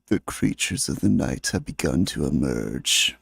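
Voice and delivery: very low, dramatic announcer voice; deep movie trailer voice. Shown as a demonstration. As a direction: boom it like a horror trailer. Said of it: "how is my impersonation?".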